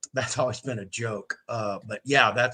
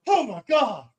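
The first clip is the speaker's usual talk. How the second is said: high, startled exclamation; retold shock; 11 semitones higher, 4 dB louder than usual. Friends seeing him jump up, shocked. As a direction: imitate a stunned roomful of friends.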